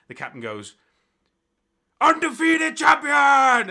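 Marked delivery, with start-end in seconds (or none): 2.0-3.7 drawn-out, booming high shout; announcer shout. Acted. Captain hails the winner to the crowd, booming shout. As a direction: proclaim the champion to the crowd.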